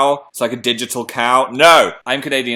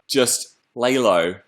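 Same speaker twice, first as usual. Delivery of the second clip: usual speaking voice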